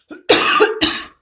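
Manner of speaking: a loud, harsh burst of coughing; coughing fit. Real, not acted. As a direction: cough hard right into the mic. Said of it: "eu tô tossindo que nem um louco hoje".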